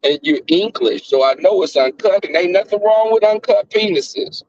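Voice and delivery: usual speaking voice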